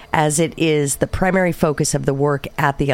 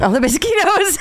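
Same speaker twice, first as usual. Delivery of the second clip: laughing. Real, not acted. Laughing echo.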